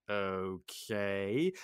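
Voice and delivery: low, drawn-out, rising at the end; acted dubious response; sceptical okay. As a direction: humour him doubtfully. Gaston doubts Maurice's tale.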